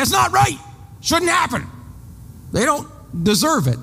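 high, aggrieved protest; indignant complaint. Retold or imitated. To the congregation, mimicking everyone's indignant protest. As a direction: whine that it isn't fair. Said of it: "We say".

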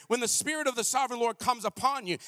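ordinary talk, nothing marked

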